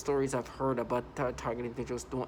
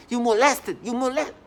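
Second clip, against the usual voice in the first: higher, louder imitated accusation; mock yelling; 9 semitones higher, 9 dB louder than usual. Imitating people shouting accusations at him. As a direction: shout it as a taunt. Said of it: "or they open".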